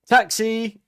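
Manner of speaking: high, loud mocking shout; bully yells taxi. School bully shouting taxi at the girl in yellow, loud and high. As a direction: bellow it across the corridor. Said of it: "one of them goes".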